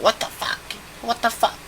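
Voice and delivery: high, soft, whispery bafflement; hushed disbelief. Retold or imitated. Baffled at friend dozing on his back, hushed high disbelief. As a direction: squeak it out under your breath, incredulous. Disbelief that she is falling asleep.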